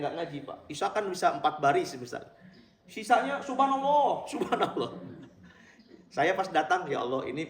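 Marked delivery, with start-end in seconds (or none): 2.9-4.9 mock congregation, laughing. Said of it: "Bilang apa?".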